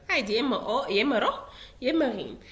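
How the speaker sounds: ordinary talk, nothing marked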